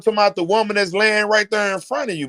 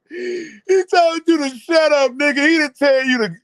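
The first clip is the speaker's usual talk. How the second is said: comic exasperation; 8 semitones higher than usual. Real, not acted. Reactor berating the father for reciting, high exasperation. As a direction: scold him in a pleading wail.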